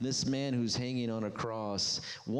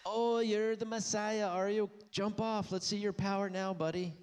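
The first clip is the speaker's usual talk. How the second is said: higher, sneering mock jeer; mocking taunt; 10 semitones higher than usual. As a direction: taunt him like the scoffers at the cross. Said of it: "They were making fun of him".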